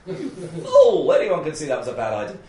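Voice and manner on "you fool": slow scolding why, then a high mocking You fool; mock-angry question ending in a laughing high squeal; scornful demo. Shown as a demonstration. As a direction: berate them, voice leaping up on the insult. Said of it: "probably say this twice".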